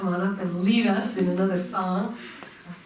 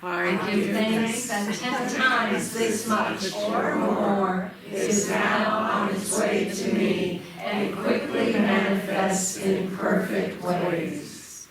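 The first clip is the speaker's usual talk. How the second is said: group prayer recitation; 4 dB louder than usual. Acted. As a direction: lead the room through a recited blessing. Leads congregation in spoken offering prayer.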